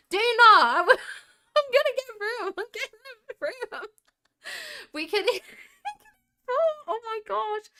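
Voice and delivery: high, loud shriek breaking into real laughter; laughing hard. Real, not acted. Streamer cracking up at the live chat, laughing hard. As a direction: squeal it through helpless laughter.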